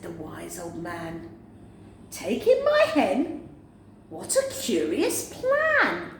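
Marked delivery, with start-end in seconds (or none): 2.1-6.1 put-on high, doubtful old woman; old woman, puzzled. Old woman doubting the wise man's plan, high and puzzled. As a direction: question the odd advice in disbelief.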